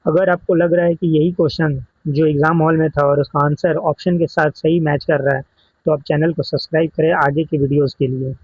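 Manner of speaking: ordinary talk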